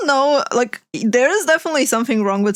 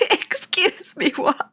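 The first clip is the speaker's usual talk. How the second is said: high burst of laughter into a slow "excuse me"; real laughing disbelief; 14 semitones higher, slower than usual. Real, not acted. Streamer reacting to the eye-contact videos, laughing in disbelief. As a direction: laugh helplessly, then question it in disbelief. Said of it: "I'm sorry for laughing".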